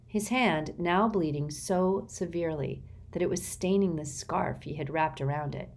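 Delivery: ordinary delivery, nothing marked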